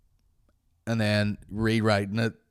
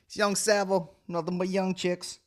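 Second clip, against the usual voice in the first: higher mock rapper voice; a lightly voiced cocky rapper; rapper persona; 9 semitones higher than usual. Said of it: "That's my new rapper, rap name".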